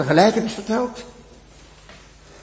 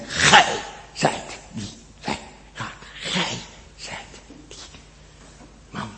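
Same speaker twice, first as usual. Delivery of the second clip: slow, hushed whisper; whispered verdict; 7 semitones lower, 11 dB quieter than usual. Acted. The Lord whispering his verdict to the congregation, hushed. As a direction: breathe the judgement out slowly.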